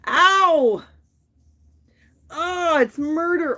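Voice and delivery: loud high yelp of pain; pained cry. Real, not acted. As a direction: cry out at a sharp twinge.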